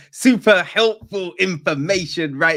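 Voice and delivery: self-mocking. Real, not acted. Mocks own useless half-remembered news.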